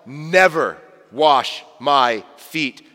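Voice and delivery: slow, deliberate reading of Peter's refusal; a hint of Peter digging in; belligerent Peter. Peter refusing Jesus, slow and firm. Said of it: "he says".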